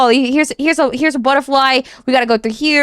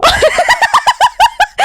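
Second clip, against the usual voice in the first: very high, loud squealing laugh; overwhelmed giddy shriek; 3 dB louder than usual. Real, not acted. Laughing at reveal again.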